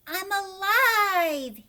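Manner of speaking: high, loud and drawn-out cry of joy; joyful shout. A narrator's reading. Raindrop newly born shouts for joy as he falls, loud and stretched. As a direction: burst out with the thrill of being alive. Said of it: "he shouted".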